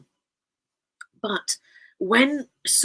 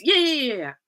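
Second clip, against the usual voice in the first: dismissive yeah yeah; 7 semitones higher, 4 dB louder than usual. Retold or imitated. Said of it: "my answer to my dad was".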